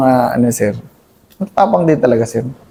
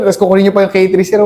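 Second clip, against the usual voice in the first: ordinary delivery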